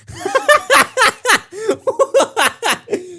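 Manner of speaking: high-pitched helpless laughing fit; burst of laughter. Real, not acted. Reading a friend's DMs aloud, bursting into high laughter. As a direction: crack up, can't stop. Cracking up at the DM.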